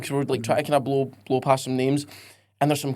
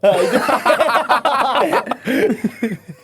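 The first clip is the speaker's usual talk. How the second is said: laughing; 7 semitones higher, much slower than usual. Real, not acted. Laughing reply to a silly quote.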